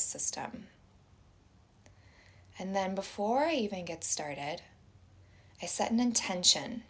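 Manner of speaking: soft, unhurried meditation voice with long pauses; guided meditation. Acted. Teacher inviting everyone to close their eyes and breathe, guided meditation.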